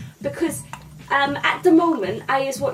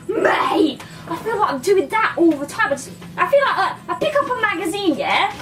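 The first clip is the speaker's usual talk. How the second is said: high, loud, worked-up agreement; excited confession; 8 semitones higher, 3 dB louder than usual. Retold or imitated. Comedian retelling his keen agreement with the actor, excited. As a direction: blurt it out, pitched right up.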